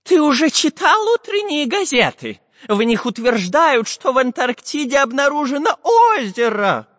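high, excited professor on the phone; breathless with news, pitched high; too much caffeine. Acted. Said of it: "взволнованно кричал".

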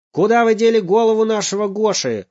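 loud, high furious shout. Acted. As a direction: storm at the clerk. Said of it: "закричал папа".

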